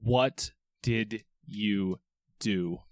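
slow, low and puzzled; low confused anger. Acted. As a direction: drag it out, baffled and cross. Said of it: "looking confused and angry".

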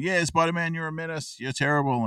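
high-pitched mock crowd heckle; jeering crowd. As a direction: lightly imitate a crowd turning on someone. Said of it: "the crowd turn and going".

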